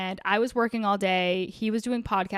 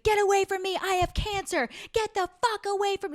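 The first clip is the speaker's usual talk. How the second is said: imitated yelling; 10 semitones higher than usual. Podcaster imitating a woman at Trader Joe's, high-pitched yelling. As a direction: mimic a stranger yelling people away. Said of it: "she was yelling at people".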